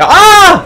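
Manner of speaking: sudden loud, high scream; startled scream. Real, not acted. Player jumped while looting the area, startled scream.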